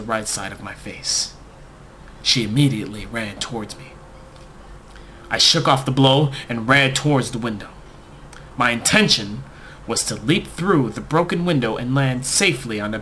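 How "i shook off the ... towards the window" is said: plain reading voice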